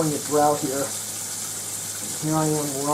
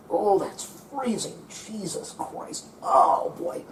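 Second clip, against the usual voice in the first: gasping at cold; 6 semitones higher, 3 dB quieter, much slower than usual. Real, not acted. Hands in freezing ice cream.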